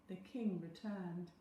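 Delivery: plain reading voice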